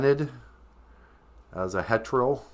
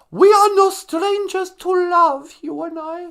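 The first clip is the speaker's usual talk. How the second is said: Greta Thunberg; 14 semitones higher, 7 dB louder than usual. Acted. Activist recites song lyrics to the host, mock high declaiming. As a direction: start loud and high, then drop in volume. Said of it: "Greta Thunberg".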